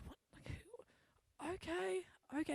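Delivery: imitated confusion, very soft; baffled muttering. Confused woman walking away from shopper, baffled muttering. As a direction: mutter it to yourself, barely audible, as if puzzled.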